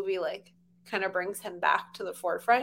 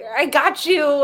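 loud, slow mock yell, pitched up; triumphant yell; 4 semitones higher, 6 dB louder, slower than usual. Retelling the car chase in the trailer, triumphant yell. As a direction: draw it out like a gloating movie line.

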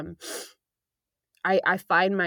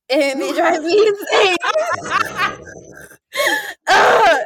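laughing through the words, pitched up and loud; exasperated, affectionate laugh in the voice; 12 semitones higher, 8 dB louder, slower than usual. Real, not acted. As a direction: crack up fondly while saying it. Laughing fondly about boyfriend.

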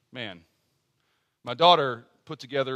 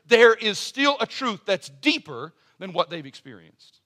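rising, insistent pulpit cadence; preacher lifting the congregation toward hope; preaching; 4 semitones higher, 4 dB louder than usual. Acted. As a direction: build the appeal line by line, louder. Opening exhortation of the sermon.

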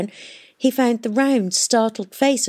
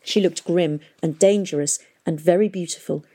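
plain reading voice

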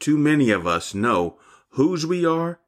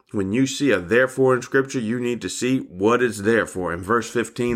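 ordinary talk, nothing marked

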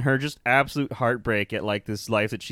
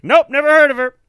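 loud, high, brushing her off; mocking shout; 14 dB louder than usual. Host imitating the husband loudly disowning her. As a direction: yell it, dismissive. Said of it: "And he's like".

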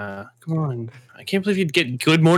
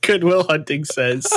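laughing; 3 semitones higher than usual. Real, not acted.

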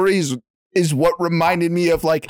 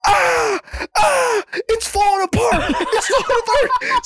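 high, laughing panicked cry; mock panic. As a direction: squeal it like the ride is breaking apart. Panicking on the ride, retold.

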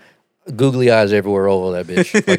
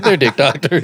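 cracking up while insisting; laughing insistence; 2 semitones higher than usual. Retold or imitated. Joking back at his doctor, laughing.

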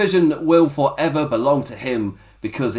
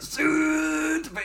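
high, slow, throat-squeezed, no Liverpudlian twang; tense neck voice, no accent; 4 dB quieter, much slower than usual. Retold or imitated. Reviewer showing Gibson's line without the accent, strained and high. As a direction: tighten the neck and drag it out high. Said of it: "the tense neck voice with it".

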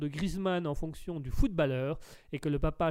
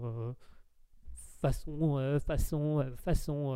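usual speaking voice

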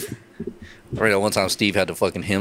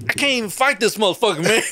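high-pitched mock disbelief; exasperated fighter giving up; incredulous retelling; 11 semitones higher than usual. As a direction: protest in a high, baffled voice. Retold fighter gives up in disbelief.

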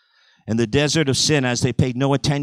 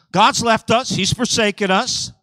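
high, urgent mock alarm; alarmed complaint; 7 semitones higher than usual. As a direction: imitate people wailing that they are abandoned. Voices the abandoned people's alarm.

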